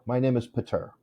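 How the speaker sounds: "Pater" said puh-TUR, softly; soft aside, "Pater" with a stressed last syllable; name stressed on second syllable. Shown as a demonstration. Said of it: "if the accent was on the second syllable".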